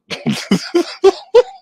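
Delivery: high-pitched burst of laughter; real laugh. Real, not acted. Host amused by own IRS joke, laughing. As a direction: crack up in high, loud bursts.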